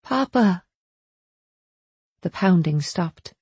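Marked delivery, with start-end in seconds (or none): 0.0-0.6 high call that falls at the end; insistent call. A narrator's reading. Belle trying to get Papa's attention, insistent call. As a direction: call for him more firmly, voice raised in pitch. Belle calls Papa a second time.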